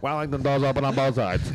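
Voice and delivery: automated announcement voice. Retold or imitated. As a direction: announce it like a train speaker. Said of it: "it's like an automated voice".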